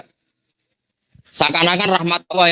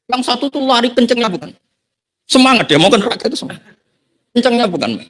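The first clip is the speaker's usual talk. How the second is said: excited; 10 semitones higher than usual. Real, not acted. Excited retelling of eager sinner.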